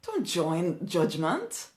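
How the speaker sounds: soft, slow, each word weighed; hushed slow warning; slow, quiet emphasis. Real, not acted. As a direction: lean in and warn gently.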